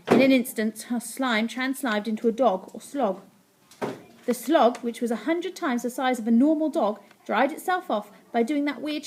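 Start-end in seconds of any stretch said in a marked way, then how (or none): none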